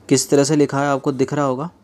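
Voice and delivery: usual speaking voice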